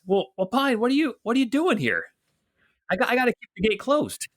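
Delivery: high-pitched, hurried soldier at the gate; gate soldier Bert, flustered. Acted. Gate guard surprised to see Pine, high and hurried. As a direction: pitch it well up, talking quickly.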